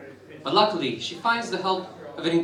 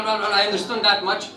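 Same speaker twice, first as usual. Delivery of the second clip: ordinary delivery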